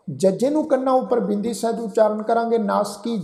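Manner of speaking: ordinary delivery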